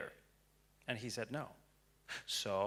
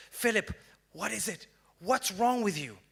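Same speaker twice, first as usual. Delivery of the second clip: higher, louder frantic pleading; desperate plea; 7 semitones higher, 7 dB louder than usual. Retold or imitated. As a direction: beg him to tell you, in a panic. Desperate plea to sobbing Philip.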